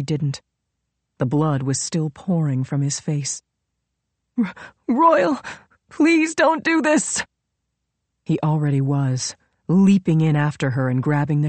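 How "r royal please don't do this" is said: high, slow frightened begging; terrified pleading. Acted. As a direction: beg him through terror. Begging for her life.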